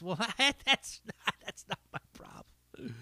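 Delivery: laughing, fading to a whisper. Real, not acted.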